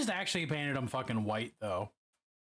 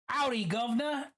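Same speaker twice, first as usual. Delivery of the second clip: mock British greeting, pitched higher and a bit louder; British accent; 9 semitones higher, 3 dB louder than usual. Streamer greets a chatter in a put-on British accent. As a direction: throw on a jokey British accent for the hello. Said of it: "Hearing howdy from a British accent's weird".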